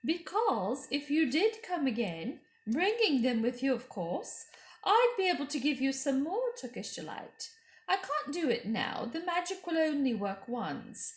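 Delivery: high, loud tempting witch voice with a laugh; scheming Queen. A narrator's reading. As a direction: dangle the sweets like bait. The Queen's voice in narration.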